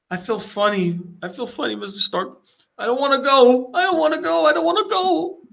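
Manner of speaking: high voice climbing into mock panic; building from uneasy to desperate, imitated; acted pleading. Acting out Peter fading in fear.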